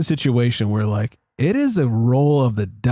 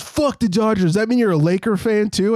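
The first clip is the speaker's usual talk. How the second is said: mock indignant driver; 8 semitones higher than usual. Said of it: "And he goes".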